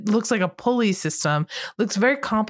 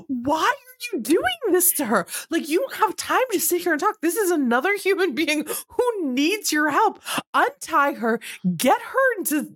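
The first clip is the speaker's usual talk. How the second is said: high-pitched indignant outburst; comic exasperation, pitch soaring; exasperated; 8 semitones higher than usual. Retold or imitated. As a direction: scold them in high-pitched, incredulous disbelief. Exasperated outburst at the film's girls.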